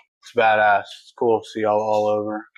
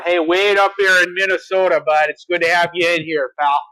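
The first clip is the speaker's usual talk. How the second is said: mock Minnesota accent; 5 semitones higher than usual. Host greeting a Minnesota viewer in a playful mock Minnesota accent. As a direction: greet them like a Minnesota local, hammed-up.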